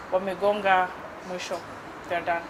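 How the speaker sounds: normal talking voice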